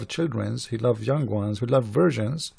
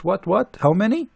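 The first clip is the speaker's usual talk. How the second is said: ordinary talk, nothing marked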